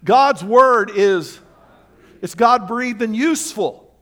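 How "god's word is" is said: slow, loud unison reading; slow congregational recitation; read aloud together. Shown as a demonstration. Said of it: "Read number two with me".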